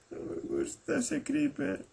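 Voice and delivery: soft, high-pitched whimper; quejido asustado. Pretend. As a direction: whimper it in a small falsetto. Whimpers about the creeper. (quejido flojito y muy agudo)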